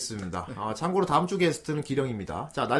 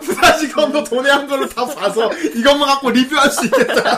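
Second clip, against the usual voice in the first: laughing; 9 semitones higher, 9 dB louder than usual. Real, not acted. Joking, laughing loudly.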